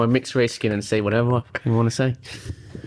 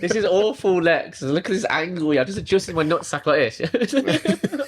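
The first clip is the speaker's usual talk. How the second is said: higher, laughing embarrassed protest; giggling through the complaint; 6 semitones higher than usual. Real, not acted.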